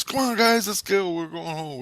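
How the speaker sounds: high-pitched call that eases down; imitating someone rounding up the group. Leader guy calls his munchkins home, calling out.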